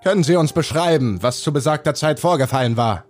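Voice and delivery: polite questioning policeman; police interrogator. Acted. Police officer questions grandmother.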